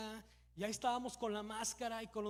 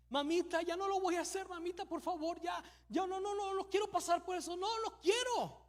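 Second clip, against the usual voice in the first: put-on higher, louder pleading child's cry; small boy pleading with his mother not to be hurt, mock crying; imitating a begging child; 7 semitones higher, 4 dB louder than usual. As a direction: beg like a scared little boy who knows the shot is coming. Child begging before injection.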